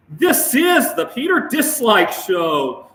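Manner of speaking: very slow, loud, high announcer shout; show intro shout. Pretend. Host announcing his own show, slow booming shout. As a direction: belt out the show title like a hype announcer.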